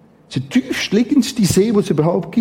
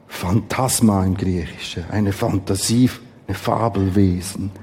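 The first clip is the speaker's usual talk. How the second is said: ruhig und tiefer vorgelesen; Bibellesung; 9 semitones lower than usual. Acted. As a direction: lies den Text gesammelt und gemessen vor. Liest Matthäus vor, leiser. (feierliche, leise Schriftlesung)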